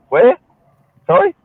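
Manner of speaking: mock baffled questioning; confused questioner. Acting out the confused reply to a fake Australian, baffled. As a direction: play someone who can't believe what they heard. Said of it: "that'll really confuse everybody".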